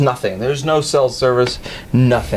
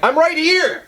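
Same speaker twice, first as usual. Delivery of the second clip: mock exasperated yell, much higher; retold frantic reply; 13 semitones higher than usual. Hunter to caller who asks where he is, high mock yell. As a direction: pipe it up high to be found.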